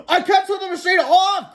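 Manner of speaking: very high, loud desperate cry; panicked cry. A narrator's reading. Donnie to the egg-soaked crowd, panicked cry. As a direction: shout it in panic. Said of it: "cried Donnie".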